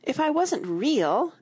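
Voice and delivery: high, slow, slightly tearful reasoning; a touch of tearfulness; half-laughing through tears. A narrator's reading. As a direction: lift the voice, gently upset. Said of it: "half laughing through her tears".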